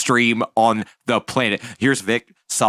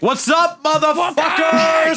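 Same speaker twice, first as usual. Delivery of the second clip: hammed-up high-pitched hype shout; huge mock-rowdy greeting; John; 4 dB louder, slower than usual. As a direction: yell it like an over-the-top streamer intro. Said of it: "John's like".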